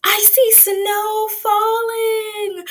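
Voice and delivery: put-on high, slow, amazed child voice; teacher playing a child amazed by snow; excited wonder. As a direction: marvel at the snow. Teacher acts child seeing snow.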